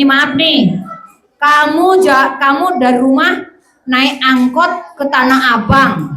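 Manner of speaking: ordinary talk, nothing marked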